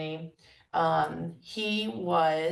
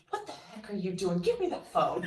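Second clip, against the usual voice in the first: mock gruff, laughing. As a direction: laugh while you imitate his mild bark. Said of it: "he was really being incredibly gruff".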